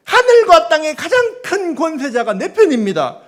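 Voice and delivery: wife telling the lawyer God is on her side; triumphant claim. Retold or imitated. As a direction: proclaim it like a victory cry. Wife names God as ally.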